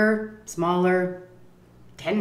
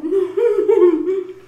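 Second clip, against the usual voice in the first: high burst of laughter; 13 semitones higher, 8 dB louder than usual. Real, not acted. Laugh about almost jail.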